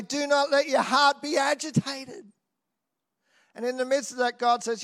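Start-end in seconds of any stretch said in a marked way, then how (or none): 0.0-2.3 raised, ringing proclamation of the verse; proclaimed verse. Acted. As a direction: declare it boldly to yourself, voice lifted high. Preacher proclaims verse to herself.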